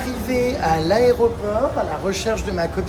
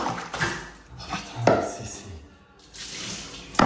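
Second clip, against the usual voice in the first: whispered curse; 9 semitones lower, 8 dB quieter, much slower than usual. Real, not acted. Exasperated aside.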